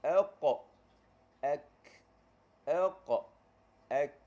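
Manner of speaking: "Elqa" lantun dan kuat, "ek" ringan; qaf lawan kaf. Shown as a demonstration. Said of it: "kita jumpa qaf dulu, baru jumpa kaf". (qaf dengan lantunan, kaf dengan angin)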